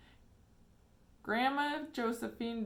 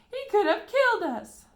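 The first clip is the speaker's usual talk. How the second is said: put-on very high, very loud old-woman shriek; screaming; 14 semitones higher, 13 dB louder than usual. A narrator's reading. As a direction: shriek in outraged fright. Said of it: "screamed Grandma Josephine".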